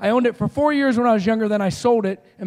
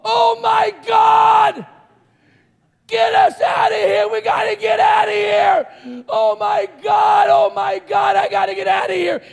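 panicked screaming; 12 semitones higher, 7 dB louder than usual. Retold or imitated. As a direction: scream for your life in mock panic. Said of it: "I'm screaming. And so is Paul".

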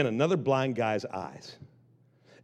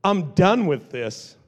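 slow, louder, each word weighed; firm declaration; 3 semitones higher, 4 dB louder, much slower than usual. Acted. Beggar throwing off his garment, slow firm louder declaration. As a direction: declare it with rising resolve.

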